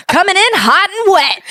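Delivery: high, loud, upbeat teacher voice; excited teacher praise. Retold or imitated. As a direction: cheer the kid on brightly. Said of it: "I wanted to crawl into a hole".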